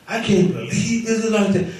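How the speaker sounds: high-pitched mock griping; complaining. Shown as a demonstration. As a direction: act out a laughing, indignant complaint. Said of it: "I shouldn't complain that way".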